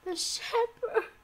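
high, drawn-out cry of despair; anguished, breaking outcry; desolate wail. A narrator's reading. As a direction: wail it slowly, high and tearful. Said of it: "wailed Umbridge in a desolate voice".

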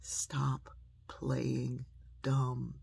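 very slow, word by word; measured, spaced-out admonition; mother's firm saying. Retold or imitated. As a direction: let each word stand alone, deliberately. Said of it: "my mother's phrase came back to me".

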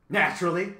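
a hint of a cackling bird. A narrator's reading. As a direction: lightly cackle the word, loud and pleased. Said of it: "the bird cackled".

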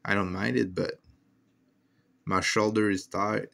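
ordinary delivery

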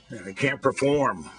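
ordinary delivery

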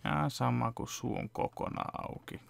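normal talking voice

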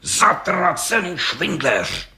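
slow, high, drawn-out curse; furious roar. A narrator's reading. Judge curses the lying brickmaker, roaring slowly. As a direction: spit out the insult, enraged. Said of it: "Zařval okresní soudce".

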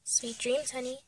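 very soft goodnight; hushed tenderness. Acted. Parent says goodnight to baby, hushed tenderness. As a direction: murmur it to a sleeping baby.

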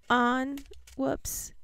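ordinary talk

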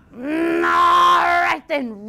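long growing roar of grudging consent; troll's roar. Acted. Troll letting the little goat go, roaring. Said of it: "roared the troll".

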